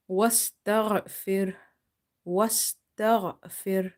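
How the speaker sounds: Wastaghfir drawn out, stopping on the r; slow pause form. Shown as a demonstration. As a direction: recite Wastaghfir very slowly. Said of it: "we will not read the ha".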